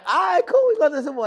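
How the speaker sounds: high, loud burst of eager agreement; loud, high-pitched excited quote; excited reply. Retold or imitated. Retelling own excited reply about plans.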